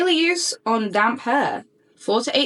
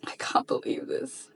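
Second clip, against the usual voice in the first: whispered disbelief, much softer; awed whisper; 10 dB quieter than usual. Real, not acted. Still stunned by the brand's gift, a whispered disbelief. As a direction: breathe it out in amazement.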